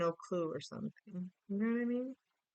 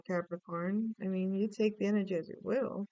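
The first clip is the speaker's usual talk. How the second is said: ordinary delivery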